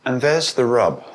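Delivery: ordinary talk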